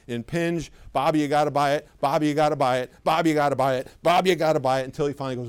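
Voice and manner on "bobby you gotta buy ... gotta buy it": louder, relentless mock nagging; pushy hard pressure. Salesperson pressuring a customer named Bobby, pushy repeated nagging. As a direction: hammer the customer over and over.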